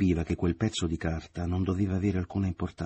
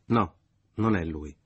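slow, louder, final no; slow firm denial; 5 dB louder, slower than usual. A narrator's reading. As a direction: deny it slowly and firmly. (negazione lenta e decisa)

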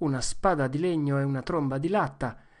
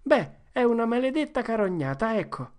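higher, petulant protest; sulky complaint; 9 semitones higher than usual. Acted. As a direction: grumble that it's unfair. Joe sulks. (Joe protesta imbronciato)

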